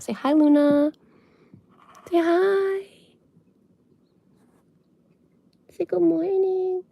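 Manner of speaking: pet voice. Real, not acted. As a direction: coax the bunny sweetly up high. Talking to the bunny.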